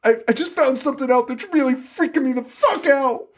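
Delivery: high hammed-up Lori shriek; mock scream. Lori tells her friend the news, high mock scream. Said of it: "she's still screaming".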